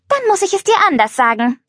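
high, sharp interruption; curt. Acted. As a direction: cut him off brusquely. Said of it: "unterbrach Violetta ihn barsch".

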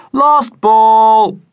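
high, loud gleeful chant of Lost; sing-song it to spite him; malicious chorus. A narrator's reading. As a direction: fielders taunting the batsman in chorus. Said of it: "the other fielders chorused maliciously".

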